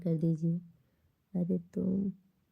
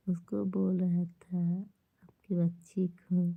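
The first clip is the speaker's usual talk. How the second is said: whisper; slower than usual. Real, not acted. Whispering near sleeping child.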